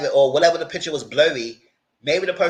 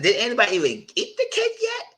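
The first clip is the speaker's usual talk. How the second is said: laughing; 8 semitones higher than usual. Real, not acted. Asking about the cake, amused.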